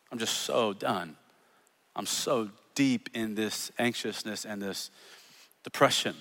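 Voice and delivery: hushed despair. Acted. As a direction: let it sink almost to nothing. Voicing a depressed believer.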